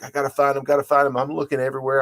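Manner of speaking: half-acted panic hunting for keys; lightly imitating a frantic search. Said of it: "I get in this frantic".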